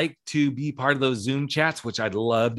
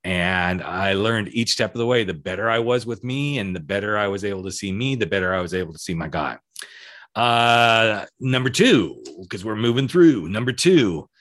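ordinary delivery

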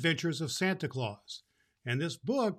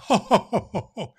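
higher, louder Santa belly laugh; jolly ho ho ho; 6 semitones higher, 8 dB louder than usual. Acted. Claus welcoming the children, jolly laugh. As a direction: laugh it out big and merry. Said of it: "with a laugh".

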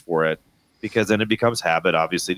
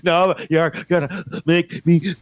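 slow, gasping mock sobs, stuttering; higher-pitched hiccuping child's cry; imitated crying; 5 semitones higher than usual. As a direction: choke out words like a sobbing child. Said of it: "why you're crying".

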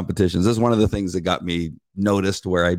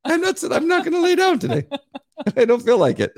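much higher, put-on stubborn dog. Voicing the dog refusing its owner, high and stubborn. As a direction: refuse like a lazy pup, high. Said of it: "He just looked at me like".

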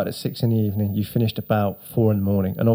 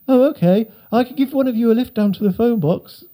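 much higher, friendly passer-by voice; cheery dog walker; 11 semitones higher than usual. Retold or imitated. Dog walker asking the stranded boys, high cheery voice. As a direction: chirp it like a kindly stranger.

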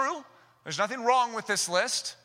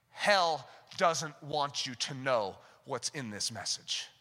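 normal talking voice

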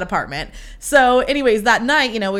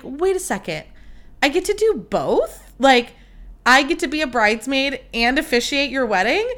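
higher, incredulous rising questions; astonished disbelief; 4 semitones higher than usual. Retold or imitated. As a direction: check it's really true, thrilled. Retelling her astonished realisation.

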